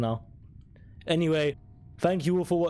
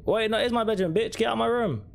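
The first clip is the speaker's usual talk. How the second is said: scared horror game streamer; 9 semitones higher than usual. Real, not acted. Sister blocks his room.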